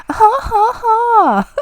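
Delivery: high, loud haughty villainess laugh; anime villain laugh. Shown as a demonstration. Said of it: "like, anime villain laugh".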